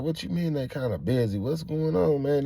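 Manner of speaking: high-pitched puzzled protest; demanding an explanation; indignant questioning. Retold or imitated. Acting out his puzzled protest.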